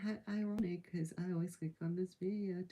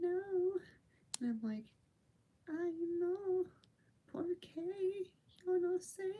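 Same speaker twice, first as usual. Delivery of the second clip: much higher, cartoonish squeak with giggles; squeaky silly voice; 12 semitones higher, slower than usual. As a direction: play a tiny giggly cartoon character. Playful squeaky character voice.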